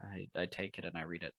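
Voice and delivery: barely above a breath; hushed. Acted. Hushed narration of own action.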